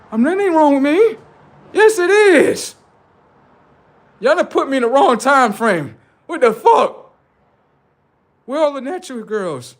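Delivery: put-on high, loud bewildered old-timer; an old man dropped in the wrong era; old man character. Old-timer shocked at modern girls, loud and high.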